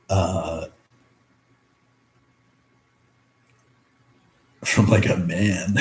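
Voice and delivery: choked up, long pauses between words; really struggling to get the words out; halting, slowed by welling emotion; emotional. Real, not acted. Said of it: "getting a little emotional".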